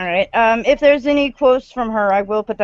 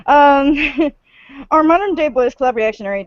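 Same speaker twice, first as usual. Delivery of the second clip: ordinary talk, nothing marked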